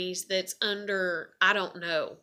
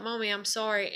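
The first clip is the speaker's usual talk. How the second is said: usual speaking voice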